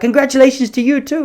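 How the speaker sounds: high, cheeky comeback; mock vendor tease. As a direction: toss it back with a smirk. Vendor teasing back.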